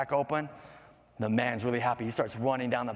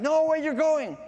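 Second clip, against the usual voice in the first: high, louder cry after departing friends; alarmed raindrop; 13 semitones higher, 6 dB louder than usual. Acted. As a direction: call out high and loud, dismayed. Raindrop calls to falling friends.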